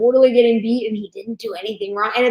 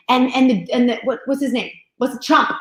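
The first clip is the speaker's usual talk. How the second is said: ordinary delivery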